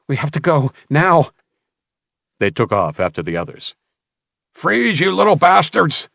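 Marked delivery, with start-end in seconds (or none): 0.1-1.3 urgent, rising shout to flee. Acted. Cade urging Samuel to flee, urgent shout. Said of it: "Cade stumbled to his feet and gripped". 4.5-6.1 high, loud roaring villain. Acted. Mason yelling at fleeing children, furious roar. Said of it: "roared Mason".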